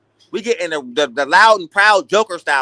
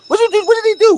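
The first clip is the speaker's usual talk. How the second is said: much higher, squeaky disbelief; incredulous; 13 semitones higher, 4 dB louder, faster than usual. Real, not acted. Mocking Manny for copying Rocky, high incredulous squeak. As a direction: shoot up high in mock disbelief.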